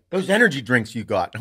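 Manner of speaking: a touch of caffeinated urgency; jacked up. Retold or imitated. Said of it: "like, a little jacked up".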